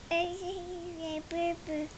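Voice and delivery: ordinary delivery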